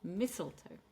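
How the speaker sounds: "mistletoe" with stressed Mis, falling away; first-syllable stress. Shown as a demonstration. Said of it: "stress on the first syllable".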